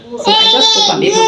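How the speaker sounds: fast high fake sobbing; hammed-up crybaby plea; whining through pretend tears; mock wail. Mock crying over corn craving.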